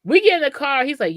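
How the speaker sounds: ordinary talk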